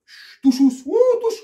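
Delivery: hammed-up Portuguese accent, high and loud, with a laugh; mock Portuguese accent, giggling; joking Portuguese accent cracking up. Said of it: "ça me fait penser à l'accent portugais".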